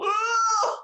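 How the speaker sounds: very high ecstatic cry; imitated audience squeal. Podcaster mimics audience members reacting, high squeal. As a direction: squeal like a fan in the crowd. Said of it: "you see people in the audience go".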